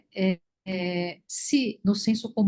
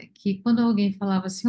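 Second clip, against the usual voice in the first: normal talking voice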